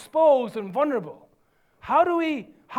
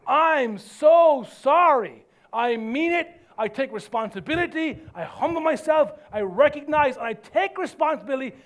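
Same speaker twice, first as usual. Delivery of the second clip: emphatic conviction; 3 semitones higher, 4 dB louder than usual. Shown as a demonstration. Preacher models owning the wrong, emphatic and raised. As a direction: declare it firmly, then finish hard. Models apology at full force.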